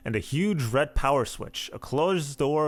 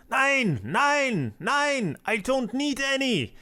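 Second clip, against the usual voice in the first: put-on mad scientist German accent; 12 semitones higher, much slower than usual. Mad scientist shooing a salesman away, high German accent. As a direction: shriek it like a crazed German scientist. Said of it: "in my terrible German accent".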